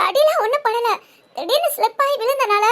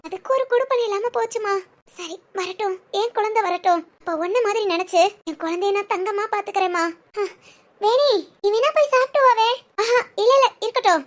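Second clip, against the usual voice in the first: ordinary delivery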